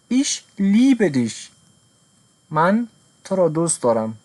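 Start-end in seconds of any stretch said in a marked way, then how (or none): 0.1-1.5 each word laid out slowly; very slow model sentence. Shown as a demonstration. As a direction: read it out slowly for beginners. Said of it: "model sentence for learners".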